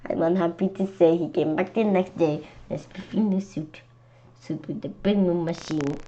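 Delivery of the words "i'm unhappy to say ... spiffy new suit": usual speaking voice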